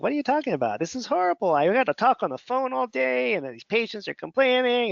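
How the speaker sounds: Mister Grumpy. Retold or imitated. Retelling his grumpy self to a friend, mock whiny complaint. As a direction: whine about the phone work like a grumpy version of yourself. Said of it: "I was just like Mister Grumpy that day".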